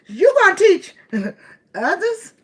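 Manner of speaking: mock quote laughing. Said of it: "a bitter bitch and bitter bum just heard".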